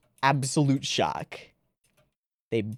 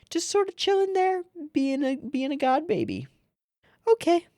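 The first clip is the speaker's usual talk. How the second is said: put-on high squeaky baby voice. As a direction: coo it like a tiny baby. Voicing a baby character.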